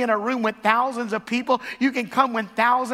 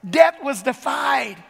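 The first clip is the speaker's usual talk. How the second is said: triumphant shout; 3 semitones higher, 3 dB louder, slower than usual. Real, not acted. Preacher proclaiming to the congregation, a slow triumphant shout. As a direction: declare victory over death.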